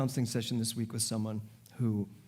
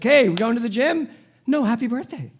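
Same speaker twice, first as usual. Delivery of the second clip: higher and louder, bubbly friend; cheerful greeting; 9 semitones higher, 9 dB louder than usual. Retold or imitated. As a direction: greet her brightly at the door. Friend Wendy voiced cheerfully.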